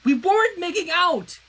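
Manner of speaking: loud, high, angry retort; growled. Acted. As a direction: snap it back in anger. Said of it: "Princess Twilight growled".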